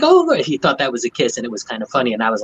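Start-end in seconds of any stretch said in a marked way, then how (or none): 0.0-0.4 high, louder mock sigh of bliss; blissful patient. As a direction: swoon high and loud. Patient thinks he got kissed.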